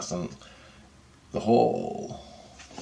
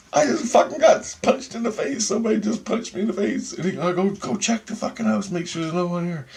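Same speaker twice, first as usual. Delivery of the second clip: panicked; 11 semitones higher than usual. Retold or imitated. Panicked plea to cousin.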